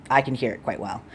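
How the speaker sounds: normal talking voice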